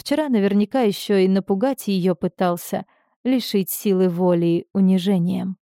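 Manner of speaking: plain reading voice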